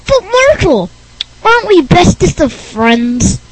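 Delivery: pleading, oddball character voice; Rick Berner's strange voice. Acted. Unwanted visitor pleading with the host, strange voice. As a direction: plead like a clingy weirdo. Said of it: "thinks Rick Berner talks strange".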